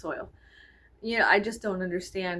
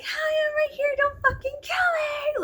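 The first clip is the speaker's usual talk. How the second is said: high, slowed mock-panicked yell; retold yell at driver; 4 dB louder than usual. Pedestrian yelling at a honking driver, high and panicked. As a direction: pitch it way up, pleading not to be hit. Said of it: "I was like".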